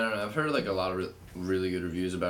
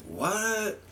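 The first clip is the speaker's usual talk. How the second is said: shocked; 14 semitones higher than usual. Retold or imitated. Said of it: "and I was like".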